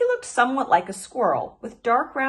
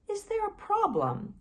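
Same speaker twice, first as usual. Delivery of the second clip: much higher, soft and gentle; 12 semitones higher, 3 dB quieter than usual. A narrator's reading. Fox emerging from the brush, gentle and soft. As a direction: ask softly from the brush. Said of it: "gentle and soft".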